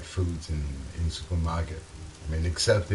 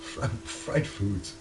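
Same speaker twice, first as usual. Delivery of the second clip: Fried foods stretched slowly under the breath; whispered mock American; slow breathy American take; 3 semitones higher, 4 dB quieter, slower than usual. Shown as a demonstration. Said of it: "come on".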